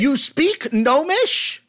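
high, slow incredulous question; mock disbelief. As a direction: drag it out in disbelief. DM shocked at player's language.

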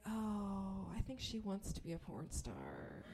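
hushed, pitying aside; imitated quiet gossip; judging onlookers. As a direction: murmur it softly about someone nearby. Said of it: "looking at me a little bit differently".

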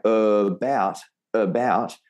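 "about" with an overdone uh in front; exaggerated schwa. Shown as a demonstration. Teacher exaggerates for students who can't hear it, uh in "about". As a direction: lean on the weak uh that opens "about". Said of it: "I almost have to exaggerate it".

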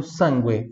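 sangue as "SAN-gweh"; Italian pronunciation. Shown as a demonstration. Teacher repeats for students, sangue in Italian with "gw". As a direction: say sangue with an audible U. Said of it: "em italiano".

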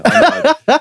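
high squeaky. Real, not acted. Repeated, much higher.

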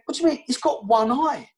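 high, outraged pet shop owner voice; indignant shopkeeper. Retold or imitated. Pet shop owner scolding the player, high outraged voice.

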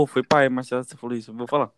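a mock-dopey copy of Bia; mimicking friend. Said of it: "A Bia falou". (imitando a amiga, voz mais grave)

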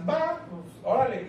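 high, breezy agreement; a high, casual okay; imitating Atenas. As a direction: mimic her easy yes in a high voice. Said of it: "Y Atenas".

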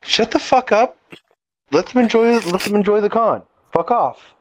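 high, sharp mock snap; annoyed con-goer. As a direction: snap at them from the crowd. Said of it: "people will just turn around and go".

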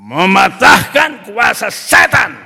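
slow, very loud proclamation; booming, stretched-out prayer shout; forceful prayer declaration. Retold or imitated. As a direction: thunder it out against the enemy. Retold prayer bursts into declaration.

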